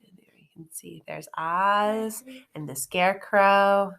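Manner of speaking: hushed pointing to the picture; whispered aside. Real, not acted.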